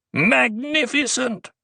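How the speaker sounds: a gleeful chortle, pitched high; chortling. A narrator's reading. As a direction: crow it with delight. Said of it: "Bijaz chortled".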